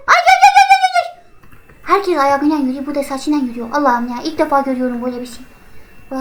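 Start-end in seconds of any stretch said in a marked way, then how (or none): 0.1-1.2 scared squeal. Real, not acted. Scared by the witch chasing.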